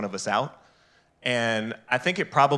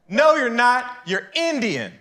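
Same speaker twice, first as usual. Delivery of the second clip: much higher, louder insistent woman; 12 semitones higher, 3 dB louder than usual. Retold or imitated. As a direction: shut him down like the woman, high. Acted woman's retort.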